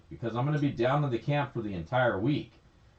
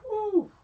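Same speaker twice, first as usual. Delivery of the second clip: impressed whistle-like whew. Real, not acted. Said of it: "that was some good".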